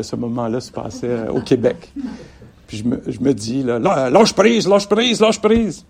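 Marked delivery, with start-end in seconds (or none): 3.6-5.8 high, loud Québécois self-urging; Québécois self-talk. Retold or imitated. Talking to herself in Québécois, urging letting go. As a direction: egg yourself on in broad Québécois. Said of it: "Là, c'était en québécois".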